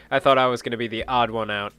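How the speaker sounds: ordinary talk, nothing marked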